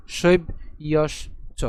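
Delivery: শৈব ইয়স চ slowly, in three parts; split pronunciation. Shown as a demonstration. Teacher answering how it sounds, slow split শৈব ইয়স চ. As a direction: leave a short gap after শৈব and yes. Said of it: "তার উচ্চারণ কেমন হবে?".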